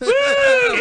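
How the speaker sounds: loud high whoop; excited whoop. Real, not acted. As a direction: let out a big cheer. Cheering the drinking word.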